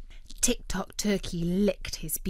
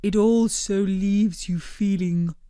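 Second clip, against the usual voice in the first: slow, even creature voice; a calm, polite blob explaining; Blobbert's voice; 3 dB louder than usual. A narrator's reading. Blobbert praising his pudding to friends, slow and measured.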